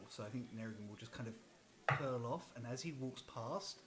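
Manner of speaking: normal talking voice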